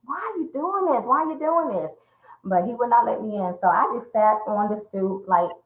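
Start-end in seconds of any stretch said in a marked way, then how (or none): 0.0-2.0 high, quick anguished asking; distraught plea. Retold or imitated. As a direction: plead with him in despair. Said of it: "I'm like".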